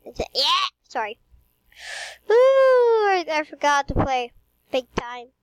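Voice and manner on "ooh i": high loud startled ooh; surprised ooh. Real, not acted. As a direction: cry out as if startled. Sudden loud exclamation.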